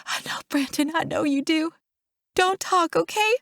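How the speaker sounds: high, choked-up soothing; distressed, tender hushing; tearful reassurance. A narrator's reading. Comforting her dying brother.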